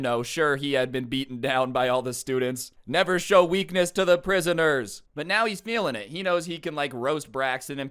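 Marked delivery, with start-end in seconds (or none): 2.9-5.0 mock stern motto. Mock motto for the beaten-down teacher.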